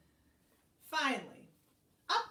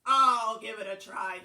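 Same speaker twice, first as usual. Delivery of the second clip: loud volunteering duck; duck character; 12 dB louder than usual. A narrator's reading. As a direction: jump in as the duck, big first word. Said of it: "he said".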